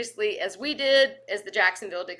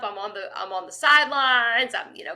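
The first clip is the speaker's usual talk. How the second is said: ordinary talk, nothing marked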